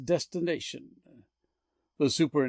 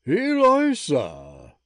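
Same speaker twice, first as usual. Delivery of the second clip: slow, louder exclamation of the name; amazed exclamation; 6 dB louder, much slower than usual. Acted. Earl wheeling round on seeing his lost love, amazed. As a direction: let the name ring out in amazement.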